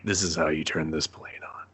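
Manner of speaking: soft whispered mock reverence; hushed whisper. Mocking Steve starting the jet, reverent whisper. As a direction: whisper like sensing the machine.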